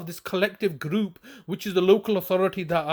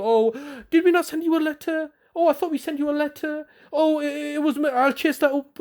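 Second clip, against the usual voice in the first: higher, breezy mock official brushing it off; mock casual official; 6 semitones higher than usual. Vlogger mocking the council officer's relaxed excuses, higher and breezy. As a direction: airily wave it away like a smug officer. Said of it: "he is taking a very relaxed view".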